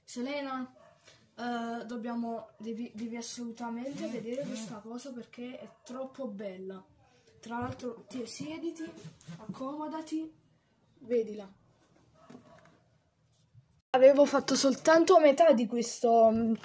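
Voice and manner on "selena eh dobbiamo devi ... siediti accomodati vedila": soft calling over; quiet. Real, not acted. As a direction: call her over gently. Calling his sister over.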